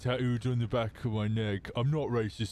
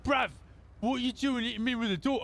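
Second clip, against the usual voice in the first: high, mock London geezer voice; British bloke; 11 semitones higher, faster than usual. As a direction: put on a cheeky cockney accent, pitched up high. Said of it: "he was like".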